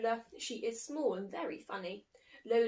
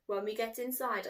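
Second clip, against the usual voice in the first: usual speaking voice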